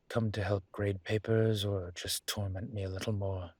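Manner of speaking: ordinary talk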